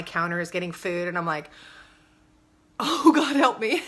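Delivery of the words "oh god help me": louder, higher "Oh, God, help me"; exasperated plea. Retold or imitated. Mom seeing son climb counters, exasperated loud plea. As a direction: cry out to heaven, at wit's end.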